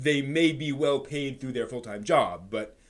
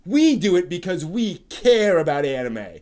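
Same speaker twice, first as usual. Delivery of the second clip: "We" stressed, loud, slow and higher; hammed-up fan scolding; mock self-righteous; 5 semitones higher, 12 dB louder than usual. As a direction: preach it with wounded pride. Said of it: "someone has a chip on, on their shoulder".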